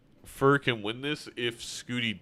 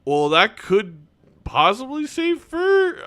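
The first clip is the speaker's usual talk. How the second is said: excited; 11 semitones higher, 8 dB louder, slower than usual. Real, not acted. Surprise turn in the match.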